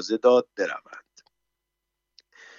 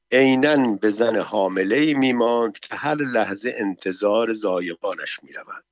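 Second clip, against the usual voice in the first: ordinary delivery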